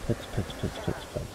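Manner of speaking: breathy whispered repetition; whispering. Real, not acted. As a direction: whisper it under your breath. Whispered to himself, unvoiced.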